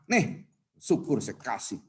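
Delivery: low, slow, grudging handout; hurtful grudging giving. Acted. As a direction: hand it over with a hurtful tone. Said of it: "tapi ngomongnya nyakitin".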